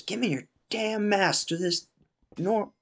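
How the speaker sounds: high-pitched retold yell; imitated yelling dad. Dad yelling at the kids' table, imitated angry yell. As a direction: play an irate father ordering his kid. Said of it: "he starts yelling over at the group of kids".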